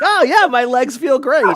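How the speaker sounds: high, loud hammed-up enthusiasm; mock delight. Playing the friend who enjoys being bashed, mock-delighted. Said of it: "you'll be like".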